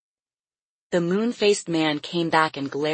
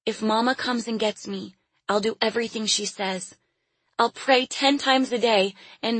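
put-on higher-pitched little girl pleading; child's desperate prayer; 6 semitones higher than usual. A narrator's reading. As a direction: bargain earnestly with God in a small, high voice. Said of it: "she prayed".

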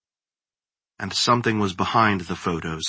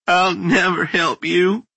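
higher, slow tearful cry; sobbing defiance; 9 semitones higher than usual. A narrator's reading. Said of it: "Kate sobbed".

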